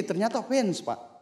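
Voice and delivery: ordinary delivery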